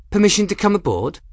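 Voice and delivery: high, quick, cheerful lady's voice; bright request. A narrator's reading. Ransom asking Kettle's permission, high and chirpy. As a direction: ask it brightly, almost singing.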